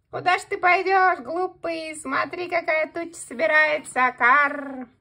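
high, loud cawing crow; mocking crow. Crow mocks the hare about the storm cloud, high and loud. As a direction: jeer at the silly hare, cawing.

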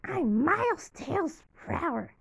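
first try at a little fox sidekick; Tails impression. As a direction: squeak it out like young Tails. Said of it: "'cause his voice is".

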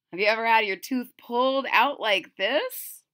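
ordinary delivery

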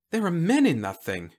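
high-pitched mild alarm; shocked. Acted. Whitlow realising men are inside, shocked. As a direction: exclaim it in disbelief.

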